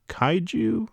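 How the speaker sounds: sharp high confused "Kaiju?"; a retold surprised echo; surprised question. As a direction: blurt it back in disbelief. Asking what the kaiju were.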